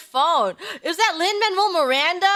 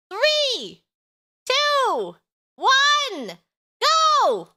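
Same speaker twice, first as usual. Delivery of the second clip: rising, louder count to go; excited countdown; 10 semitones higher, 4 dB louder, much slower than usual. Real, not acted. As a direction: build up to a big shout. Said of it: "counting down sync start".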